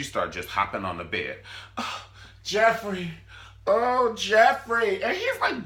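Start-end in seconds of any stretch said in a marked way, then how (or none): 1.8-5.0 loud, high, drawn-out fake moans; mock ecstatic cries. Fiance hopping on the bed, mock ecstatic cries. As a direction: cry it out in hammed-up passion.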